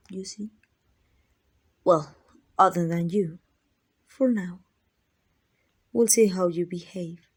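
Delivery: ordinary talk, nothing marked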